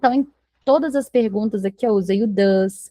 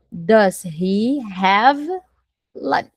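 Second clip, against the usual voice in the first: slow dictation; much slower than usual. Shown as a demonstration. Teacher builds question word by word.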